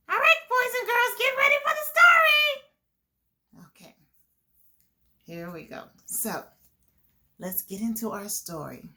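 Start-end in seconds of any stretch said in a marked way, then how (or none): 0.1-3.7 high, loud fast puppet call; rally the kids in a squeaky puppet voice; puppet announcer. Acted. Puppet calling the boys and girls to get ready, high and loud.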